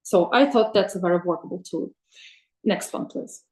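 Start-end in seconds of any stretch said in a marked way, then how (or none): none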